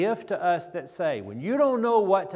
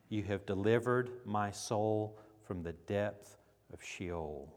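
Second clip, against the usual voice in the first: low, soft, reverent psalm reading; quiet reverent reading; soft scripture reading; 5 semitones lower, 6 dB quieter than usual. Acted. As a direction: read it as a hushed act of worship. Reads psalm softly.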